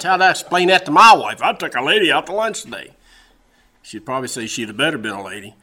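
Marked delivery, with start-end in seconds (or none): 0.0-2.9 loud, higher-pitched protest; mock alarm. Husband wondering how to explain lunch to his wife, mock alarm. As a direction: panic comically at explaining yourself. Acted alarm about wife's reaction.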